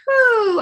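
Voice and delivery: high breathy whew; exhale whew. Real, not acted. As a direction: blow out the breath. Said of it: "breath out".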